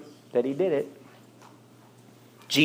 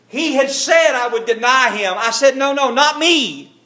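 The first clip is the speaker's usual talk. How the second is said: anguished denial; 9 semitones higher, 5 dB louder than usual. Acted. Peter recalls his denial.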